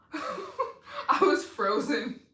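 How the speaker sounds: slow, laughing through words. Real, not acted. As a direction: laugh while saying it. Overwhelmed laughing streamer.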